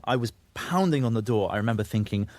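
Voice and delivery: ordinary talk, nothing marked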